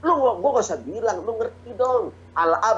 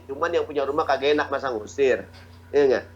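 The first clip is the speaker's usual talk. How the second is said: ordinary talk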